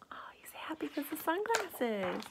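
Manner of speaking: whisper. Real, not acted. Reader's aside.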